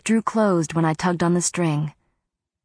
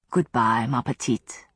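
Matthew; 7 semitones lower than usual. A narrator's reading. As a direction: drop low and gentle for him. Matthew bids the cat farewell.

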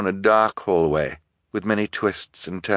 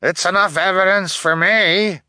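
high, loud retort; indignant elder, voice pitched up; elder's angry cry; 12 semitones higher, 5 dB louder than usual. A narrator's reading. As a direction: cry it out for the mob. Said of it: "an elder cried".

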